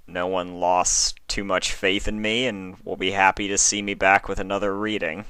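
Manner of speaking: ordinary delivery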